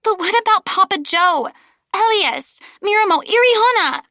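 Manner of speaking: high, loud pleading through tears; distraught child begging for her friends; tearful girl. Acted. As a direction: plead like a child about to sob. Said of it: "The girl gave a heaving sob".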